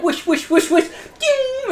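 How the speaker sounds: high giggly wish-wish chime; magic sound effect. Retold or imitated. Describing the finale to a friend, mouths a high magic chime while laughing.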